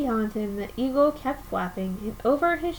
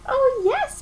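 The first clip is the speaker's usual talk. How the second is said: high and louder piped reply; piping; 11 semitones higher, 4 dB louder than usual. Acted. Mayor answering Horton, piping high. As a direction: pipe it up high like a tiny person. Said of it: "piped the voice".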